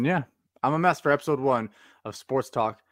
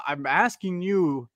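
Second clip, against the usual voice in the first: ordinary talk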